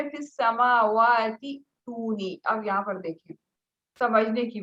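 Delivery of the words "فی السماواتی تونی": فی السماواتی تونی recited slowly, the words run together; joined recitation. Shown as a demonstration. Teacher recites the joined reading for the student, slowly. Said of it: "وصل کی صورت میں".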